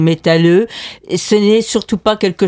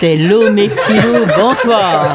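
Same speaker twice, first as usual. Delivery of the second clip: a hint of a cavernous crypt voice; 4 semitones higher than usual. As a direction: greet like something risen from a crypt. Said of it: "On reconnaît ma voix caverneuse". (voix caverneuse légèrement jouée)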